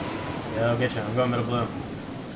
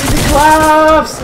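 slow, loud mocking taunt; drawn-out taunt; 3 semitones higher, 9 dB louder, much slower than usual. Real, not acted. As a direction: stretch it out to gloat. Taunting after a kill.

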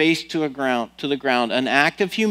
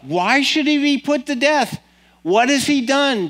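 much higher, pleading disbelief; indignant protest; 11 semitones higher than usual. A narrator's reading. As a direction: protest in shock for a friend. Son protests father's death order.

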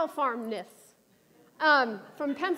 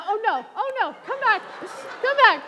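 mock panic; 5 semitones higher, 4 dB louder than usual. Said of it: "you ever have, like".

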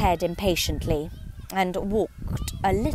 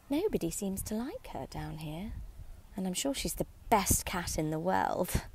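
much softer, sad murmur to herself; melancholy; 8 dB quieter than usual. A narrator's reading. Alice alone, saying to herself in a soft melancholy tone. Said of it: "in a melancholy tone".

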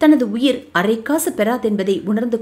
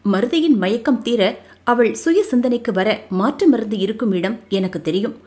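usual speaking voice

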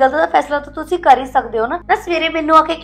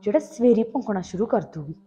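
grumbling to herself; 3 semitones lower, 7 dB quieter than usual. Acted. Wife grumbling to herself in kitchen.

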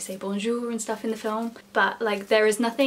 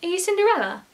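clueless guest; 8 semitones higher, 3 dB louder than usual. Retold or imitated. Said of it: "she was like".

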